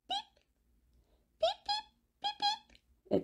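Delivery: very high, soft chirpy beeps; tiny chick beep. Acted. Chick in the egg calling to its fathers, high soft beeps. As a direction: peep like a chick still inside the egg.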